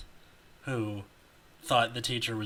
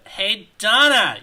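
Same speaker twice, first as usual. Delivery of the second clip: loud, drawn-out call across a room; yelling; 9 semitones higher, 13 dB louder, slower than usual. A narrator's reading. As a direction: shout her name to get her attention. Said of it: "yelled her friend".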